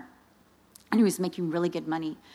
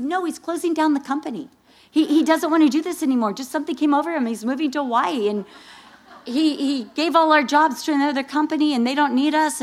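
excited explaining; 8 semitones higher, 6 dB louder than usual. Retold or imitated.

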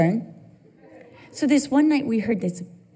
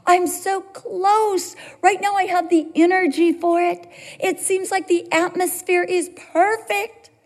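high, incredulous complaint to God; mock whine of protest, words stretched out; frustrated pleading; 8 semitones higher than usual. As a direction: plead in disbelief at being held back. Acts out frustrated plea to God.

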